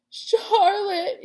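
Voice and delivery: loud, high anguished moan. A narrator's reading. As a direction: wail her name in grief. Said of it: "he moaned".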